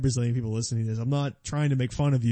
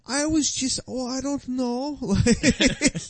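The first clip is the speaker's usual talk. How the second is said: put-on high, sheepish accented voice; mock Brazilian fighter drifting into a Swedish lilt; Brazilian accent slipping into Swedish; 9 semitones higher than usual. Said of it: "like, a Swedish guy".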